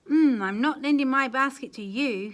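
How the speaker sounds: loud scornful no; loud refusal. Acted. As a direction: brush him off loudly.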